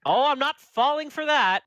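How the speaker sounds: high, loud, mock-suspicious cry; excited disbelief. DeBoer to his brother Devin, loud high disbelief. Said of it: "and he's like".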